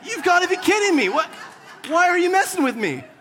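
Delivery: mock outrage at God's joke, laughing through it; laughing exasperation. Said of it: "And I'm like".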